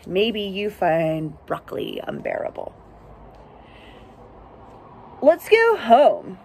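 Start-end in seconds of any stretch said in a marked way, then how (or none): 5.2-6.4 slow, louder pleading animal. Acted. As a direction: beg to go home like a freezing critter. Animals give up.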